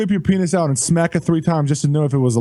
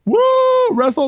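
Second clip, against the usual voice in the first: much higher, hyped-up call-out; excited shout. Acted. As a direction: shout it like a hype man. Hyping a news source.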